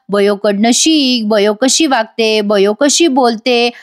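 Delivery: slow, mock scolding; mimicking father's lecture. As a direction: drag each phrase like a lecturing father. (वडिलांच्या उपदेशाची हलकी, सावकाश नक्कल)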